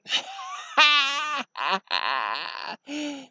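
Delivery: high, long evil laugh; drawn-out wicked laughter; evil villain cackle. Acted. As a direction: cackle like a gloating dark lord. Villain laughs after his speech.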